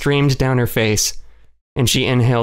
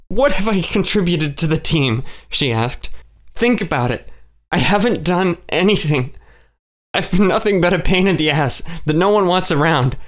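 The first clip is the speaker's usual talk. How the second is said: higher, tight, accusing herself; raised, hurt voice; bitter self-loathing; 6 semitones higher than usual. A narrator's reading. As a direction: spit out her own uselessness. Kay's self-loathing rant.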